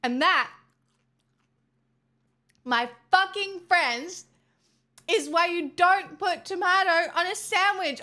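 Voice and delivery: fed-up outburst; exasperated rant. Real, not acted. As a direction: blow up at the chat over tomato. Ranting after the tomato mess.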